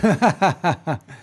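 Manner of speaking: quick amused laugh; short laugh. Real, not acted. Streamer laughing at her own joke, short laugh.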